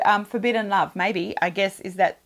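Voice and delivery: ordinary talk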